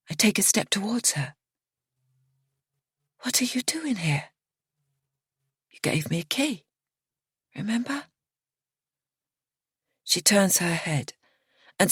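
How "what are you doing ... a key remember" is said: hushed, high, breathless whisper; startled whisper. A narrator's reading. Narrator finds Miriana in the dark, whispering. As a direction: whisper it, shaken and wary.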